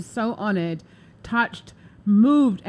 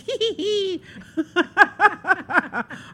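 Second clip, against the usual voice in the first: delighted laugh; 10 semitones higher than usual. Real, not acted. Excited about losing weight.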